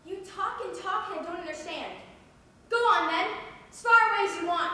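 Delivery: angry outburst. Acted. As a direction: lash out, yelling them away. Acted monologue, angry outburst.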